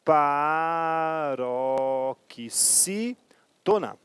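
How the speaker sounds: paroxítona stretched very slowly, each syllable drawn out; stress on paroxítona. Shown as a demonstration. Teacher to class, drawing out the rule's key word. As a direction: draw paroxítona out slowly, as if underlining it. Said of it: "acentuadas pela regra da".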